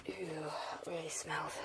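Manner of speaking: slow, disgusted whisper. Real, not acted. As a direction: whisper it slowly, repelled. Whispers about the stink.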